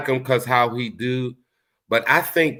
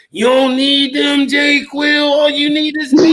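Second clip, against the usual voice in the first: mocking clingy woman; 12 semitones higher, 5 dB louder than usual. Mocking a clingy woman begging Jay Quill. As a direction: squeal it like a clingy girlfriend begging. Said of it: "She was like".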